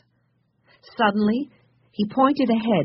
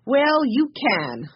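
slow, higher-pitched considering reply; thoughtful; 7 semitones higher, slower than usual. A narrator's reading. Joe granting Benny's wish after thinking, slow and thoughtful. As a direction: draw it out as if deciding. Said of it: "after thinking a minute".

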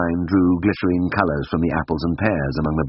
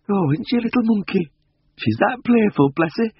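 very high, tinkling, tickled country voice; amused village woman. A narrator's reading. Missus Beck watches her cat from below, tickled and high. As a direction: coo with high amusement over a naughty pet. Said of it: "gave a tinkling laugh".

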